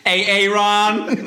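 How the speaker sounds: high, slow laughing call-out. Acted. Mocking the name reading.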